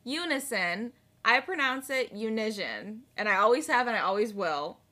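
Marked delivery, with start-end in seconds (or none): 1.2-3.0 "unision" with a zh sound in the middle; nonstandard pronunciation. Shown as a demonstration. Co-host defending her own word, "unision" said with a zh. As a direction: say "unision" to rhyme with vision. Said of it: "I do not pronounce that word".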